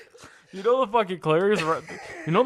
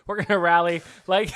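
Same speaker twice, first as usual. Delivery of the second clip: ordinary talk, nothing marked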